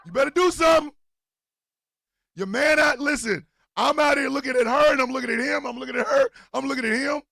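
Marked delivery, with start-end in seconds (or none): 0.1-4.6 higher and louder; mock warning shout. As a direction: yell the warning half-joking.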